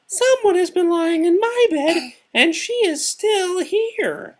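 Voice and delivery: very high, loud tiny-bear squeal; Baby Bear. A narrator's reading. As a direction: cry out in a wee, shrill, sharp voice. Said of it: "cried out in his wee little voice".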